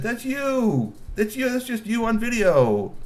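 bright high voice, pitched well up; high excited G P voice. A narrator's reading. G P explaining the video to Mister Glove, high and eager. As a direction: point at the screen, delighted.

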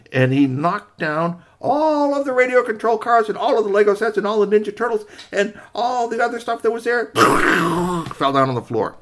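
rising, loud and high telling the crash; excited climax, stretched out at the end; building crash. A narrator's reading. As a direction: pile on the drama as it all comes down. Dramatic crash narrated.

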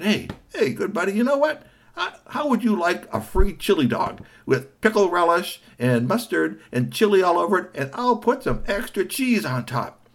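high, coaxing offer; friendly vendor. Acted. Vendor offering the kid a chili dog, high coaxing. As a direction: sell it like a treat.

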